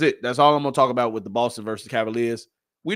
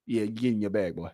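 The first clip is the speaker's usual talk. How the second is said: ordinary talk, nothing marked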